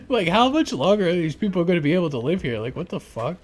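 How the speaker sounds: normal talking voice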